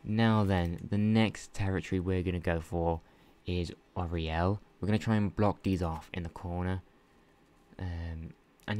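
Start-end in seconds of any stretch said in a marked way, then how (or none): none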